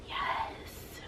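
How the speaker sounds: tiny unvoiced yes; whispered yes. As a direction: breathe out a timid yes. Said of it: "they tend to whisper to me".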